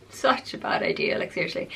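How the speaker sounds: whispered with a little laugh, half to herself; giggly whispered aside. Real, not acted. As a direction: breathe it out like a guilty confession. Laughing at her own sugar binge.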